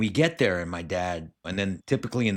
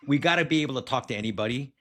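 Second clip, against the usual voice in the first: a burst of laughter spilling into quick words; laughing; 6 semitones higher, faster than usual. Real, not acted. Host laughing at his own dance story while making his point. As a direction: laugh it off and rush on.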